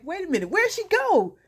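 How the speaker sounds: high-pitched acted confusion; mock frantic. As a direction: call out as if searching for someone who ran off. Acting Kendrick frantically chasing her.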